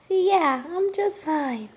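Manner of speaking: high, drawn-out pretend pet voice; voicing the dog. Owner answering as the dog that it's fine, pretend pet voice.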